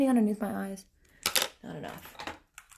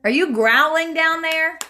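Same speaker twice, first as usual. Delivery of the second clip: louder and higher, slow call down the stairs; calling downstairs; 10 semitones higher, 7 dB louder, slower than usual. Real, not acted. Calling down to whoever is growling downstairs, loud and slow. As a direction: call out so they hear you below.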